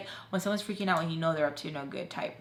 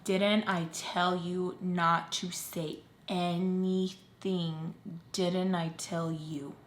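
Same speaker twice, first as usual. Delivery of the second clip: slow, softer, menacing mom voice; mom's stern reveal; 2 semitones lower, 5 dB quieter, much slower than usual. Retold or imitated. Mom confronting her over telling, slow menacing. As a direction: draw it out like a threat.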